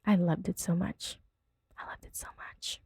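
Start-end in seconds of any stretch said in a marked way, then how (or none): 1.8-2.8 very soft whispered repeat. Real, not acted. Gushing over the tweet, whispered. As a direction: say it again under your breath, whispering.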